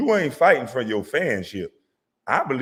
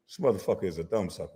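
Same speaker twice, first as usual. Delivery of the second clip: quiet quick aside; 5 semitones lower, 6 dB quieter, faster than usual. Acted.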